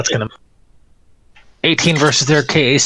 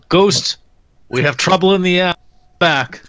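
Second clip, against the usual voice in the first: yelling to Ghost; 5 semitones higher than usual. Acted. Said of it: "[name]'s gonna yell to Ghost".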